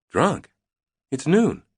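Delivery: can't believe it, it's noon; incredulous. A narrator's reading. Hallie surprised by drunk crew.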